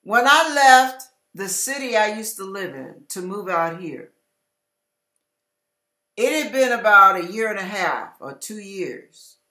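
normal talking voice

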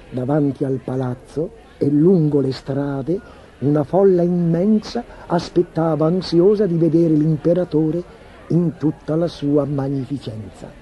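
ordinary delivery

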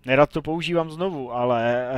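loud exasperated aside. Real, not acted. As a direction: push it out over the game noise. Reacting loudly during play.

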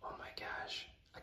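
hushed, unvoiced-breath whisper of amazement; awed whisper. Real, not acted. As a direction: whisper it as if stunned by a gift. Awed at the painted gift.

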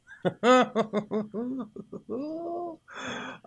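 long, high giggle trailing on; delighted laugh. Real, not acted. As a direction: laugh in gleeful disbelief. Delighted by the find.

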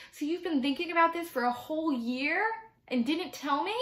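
put-on high, incredulous child's protest; indignant disbelief. A narrator's reading. Boy confronting parents over secret, indignant disbelief.

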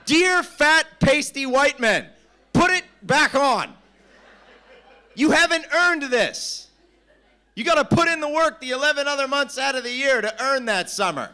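loud, high-pitched open letter rant; mock scolding. Mock address scolding shirtless men.

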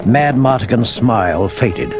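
plain reading voice

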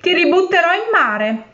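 high, triumphant threat; shouted threat. A narrator's reading. Fisherman threatening the trapped genie, high triumphant call. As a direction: crow the threat over the sealed jar. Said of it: "gridò".

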